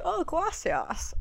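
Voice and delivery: put-on a slow, high "Oh, gracias" in a sweet gringa accent; drawn-out gracias. Thanking the mango vendor, slow high and sweet. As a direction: stretch the gracias high and soft.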